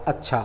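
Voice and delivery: short, low, soft अच्छा; acknowledging agreement. Shown as a demonstration. Teacher showing "yes, I understood", low acknowledging अच्छा. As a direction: say अच्छा briefly and quietly, as a plain okay. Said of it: "कि हाँ मैं समझ गया".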